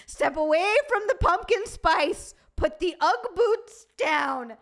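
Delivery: loud, high, drawn-out mock order; mock stern command. Streamer warns pumpkin spice fans away, mock commanding shout. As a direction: bellow a playful warning, hitting each phrase.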